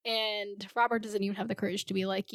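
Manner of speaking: ordinary delivery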